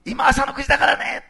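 high, loud call to a crowd. Retold or imitated. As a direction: shout it out as Peter addressing the crowd. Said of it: "声を張り上げ、".

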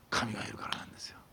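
whispered like a secret truth; awed whisper of God's presence; hushed whisper. Acted. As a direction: drop to a reverent whisper. Revealing why she was moved.

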